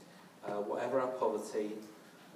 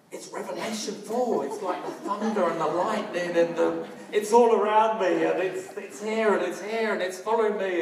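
breathless, much higher and louder rush; excited; 9 semitones higher, 13 dB louder than usual. Retold or imitated. Calling his wife from the car in the storm, wildly excited. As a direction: pour it out, overwhelmed with awe. Rang Viv amid thunderstorm.

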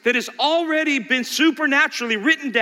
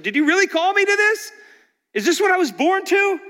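Job's complaint; 9 semitones higher, faster than usual. Retold or imitated. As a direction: cry out like Job. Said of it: "that's what Job said".

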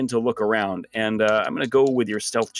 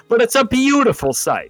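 higher, louder, lingering on every word; awed emphasis; 9 semitones higher, 4 dB louder than usual. Acted. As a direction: gush over the sight. Narrator repeats line for effect.

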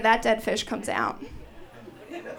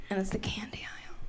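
hushed awe; 4 semitones lower, 13 dB quieter, faster than usual. Retold or imitated. Reverent candy aisle moment.

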